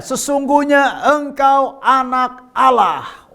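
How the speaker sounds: high, slow, drawn-out confession; worshipful proclamation. Acted. Disciples worshipping Jesus in the boat, high slow proclamation. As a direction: stretch every word in reverent declaration. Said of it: "orang-orang yang ada di perahu itu menyembah dia".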